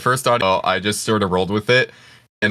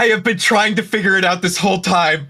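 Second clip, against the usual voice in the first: high, amazed outburst; excited realization; 10 semitones higher than usual. Real, not acted. Listener finally placing a colleague's familiar voice, excited realization. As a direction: exclaim it as the puzzle finally clicks.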